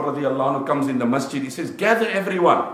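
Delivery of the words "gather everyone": calling out. Retold or imitated. Umar calls the masjid.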